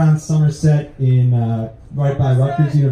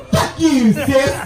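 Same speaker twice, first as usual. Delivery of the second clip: put-on sassy kid sister; 9 semitones higher, slower than usual. Sassy sister flips the bird.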